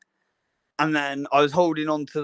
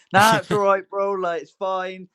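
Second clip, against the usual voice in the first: higher-pitched imitation of easygoing reassurance; breezy reassurance; 5 semitones higher than usual. Coworker brushing off the skater's worry, breezy reassurance. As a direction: wave away the worry, pitched up.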